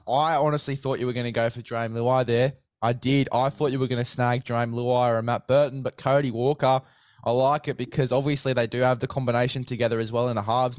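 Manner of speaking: ordinary delivery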